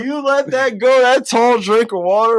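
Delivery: high, loud incredulous question; mock disbelief. Fan asking Tom how he let her go, high incredulous. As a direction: act stunned that he walked away.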